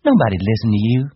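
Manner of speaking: loud, high scornful woman; defiant. Acted. Wife defies Candy in quarrel.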